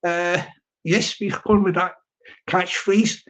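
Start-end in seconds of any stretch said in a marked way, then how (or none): none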